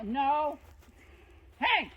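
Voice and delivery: sharp loud call to the dog; shouting at a dog. Real, not acted. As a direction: yell to stop the dog. Dog runs after animal.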